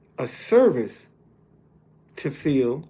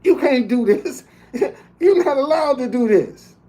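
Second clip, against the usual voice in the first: laughing retort; 7 semitones higher than usual. Retold or imitated. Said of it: "And I said".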